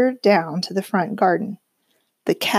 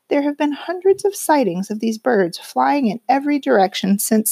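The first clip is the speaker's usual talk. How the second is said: bright broadcast read, a bit higher; newsreader voice; 4 semitones higher than usual. A narrator's reading. Narrator voicing the TV newsreader's owl report with a bright broadcast read. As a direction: read it like the evening news anchor.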